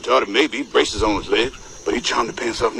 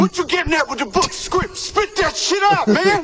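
high demanding. Acted. Actor demanding a fellow actor get to the point. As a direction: press him to spit it out.

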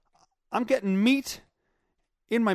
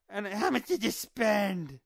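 nagging wife; 2 semitones higher, slower than usual. Retold or imitated. Wife greeting husband back with supplies, nagging impression. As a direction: do a nagging wife, drawing the words out. Wife's demand repeated.

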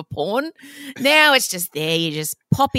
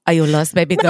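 mafia billionaire voice; 5 semitones lower, 3 dB louder than usual. Pretend. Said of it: "Italian mafia billionaire".

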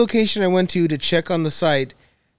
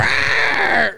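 mock roar; 5 semitones higher than usual. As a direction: roar it like an animal. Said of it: "He just".

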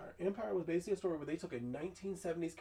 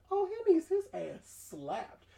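much higher, slow, mock-scandalized; outraged aside; 12 semitones higher, 4 dB louder, slower than usual. Reacting to the son spitting at his mama, slow high outrage. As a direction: gasp it out like an appalled auntie. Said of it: "I was like".

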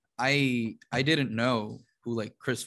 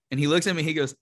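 ordinary talk, nothing marked

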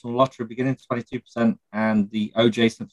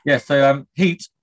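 ordinary delivery

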